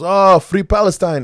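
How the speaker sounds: loud, high, slow mock shout of a slogan; retelling a stranger shouting a slogan; retold shout. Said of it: "He goes".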